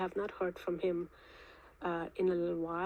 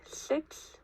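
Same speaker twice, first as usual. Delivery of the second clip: dictating; 2 semitones higher, 3 dB louder, much slower than usual. Real, not acted. Dictating case number digits.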